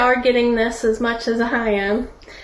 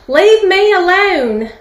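slow, high, loud yell of protest; 7 semitones higher, 5 dB louder than usual. Acted. Clay teapot to the master rolling it, yelled. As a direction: yell it out, each word drawn out. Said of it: "and I yelled out".

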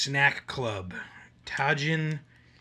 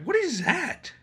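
surprised; 6 dB louder, slower than usual. Retold or imitated. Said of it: "I'm like".

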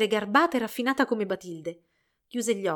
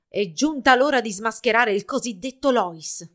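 louder, determined grumble; grumbling; 8 dB louder than usual. A narrator's reading. Jealous rival resolving to unmask Lois, grumbling. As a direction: grumble it out forcefully. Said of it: "brontolò".

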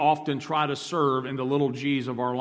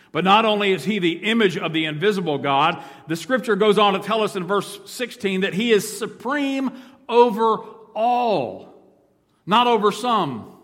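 preaching at full force; 6 semitones higher, 8 dB louder than usual. Acted. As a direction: lean hard on every word. Preaching supremacy, slowing on key words.

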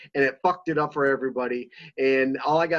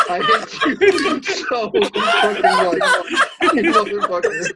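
high, loud, helpless laughter through the words; laughing hard; 5 dB louder, much slower than usual. Real, not acted. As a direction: curse them while cracking up. Cracking up at a prank.

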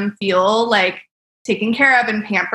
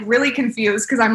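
ordinary talk

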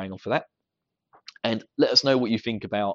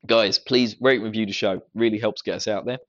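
usual speaking voice

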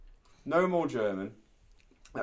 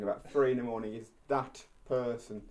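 usual speaking voice

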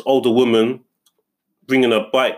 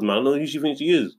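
normal talking voice

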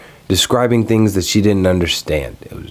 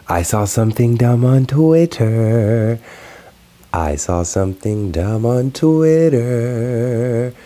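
slow, drawn-out, higher announcer read; segment title call; 4 semitones higher, much slower than usual. Acted. Vlogger announcing his recurring segment title to viewers, slow and drawn out. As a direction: announce the segment like a show host.

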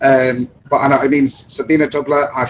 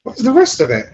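mock outrage; 9 semitones higher, 3 dB louder than usual. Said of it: "It's like".

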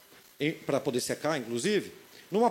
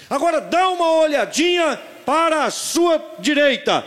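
shout; 11 semitones higher, 7 dB louder than usual. Retold or imitated. Pai grita para o filho.

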